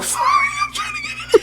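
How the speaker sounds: high squealing laugh through the words; laughing apology. Pretend. Comedian cracking up in front of [name], laughing. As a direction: giggle helplessly while apologizing. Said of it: "I'm gonna start laughing at myself".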